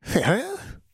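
high, rising, bewildered question; confused mumble. A narrator's reading. Tortured woman babbling, high confused mumble. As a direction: mumble it in dazed distress. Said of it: "she mumbled".

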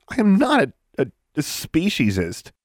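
high, spluttering denial; indignant protest. A narrator's reading. Girl accused of bigotry by the hag, indignant. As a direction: stumble over the word, offended.

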